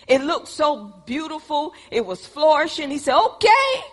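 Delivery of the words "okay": eager okay. Acted. Lot agrees eagerly.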